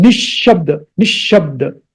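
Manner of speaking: Nishabda with the sh held and lightly stressed; doubled sh. Shown as a demonstration. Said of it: "stress little bit there on that sh sound".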